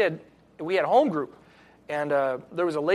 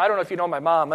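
normal talking voice

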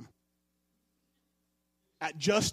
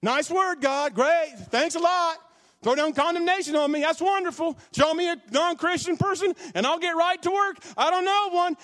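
higher, louder, mock-angry rant at God; retelling a frustrated rant at God, louder and higher; sarcastic yelling; 8 semitones higher, 3 dB louder than usual. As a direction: hurl bitter sarcasm at heaven. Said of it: "Yelling at Jesus".

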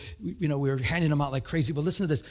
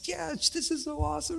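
much higher, softer gush; replying to the retiring mailman, thrilled; excited; 11 semitones higher, 6 dB quieter than usual. Retold or imitated. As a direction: gush like it's the best thing ever. Speaker's own excited reply.

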